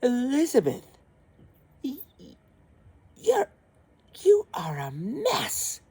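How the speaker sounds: high, stammering, shocked prince; disgusted prince. A narrator's reading. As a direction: splutter in disgust. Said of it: "he goes".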